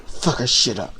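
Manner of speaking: imitating a low, hushed threat; menacing undertone. Repeating what Cedra muttered ringside, menacing undertone. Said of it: "says it under her breath with this menacing tone".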